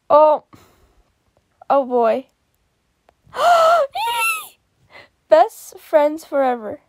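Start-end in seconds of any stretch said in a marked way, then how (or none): 3.3-4.4 sharp gasp that jumps way up; shrieking gasp; loud high gasp. Real, not acted. Reacting to a drawing.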